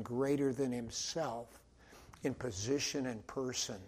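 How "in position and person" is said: ordinary talk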